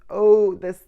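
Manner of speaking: usual speaking voice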